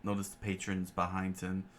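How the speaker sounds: usual speaking voice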